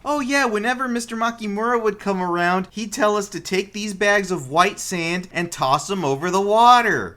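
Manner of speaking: a hint of a child eagerly explaining; lightly voiced kid.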